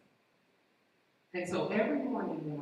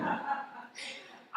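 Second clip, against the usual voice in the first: a quick airy laugh at herself; breathy laugh. Real, not acted. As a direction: laugh off the awkward truth. Laughing at her own confession.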